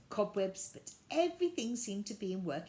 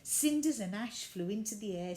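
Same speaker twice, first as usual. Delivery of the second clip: ordinary delivery, nothing marked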